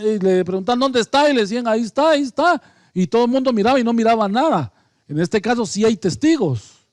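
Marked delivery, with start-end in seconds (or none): 0.8-2.6 mock excited crowd. Crowd searching for the Virgin.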